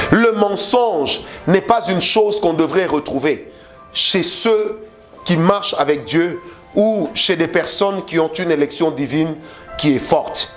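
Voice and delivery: ordinary delivery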